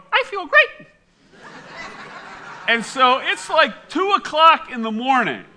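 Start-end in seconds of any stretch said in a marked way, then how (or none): 0.1-0.9 very high, perky talking-toy voice; high-pitched mock toy catchphrase; Sleep and Snore Ernie. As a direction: squeak it out like a talking doll. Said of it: "he'd go".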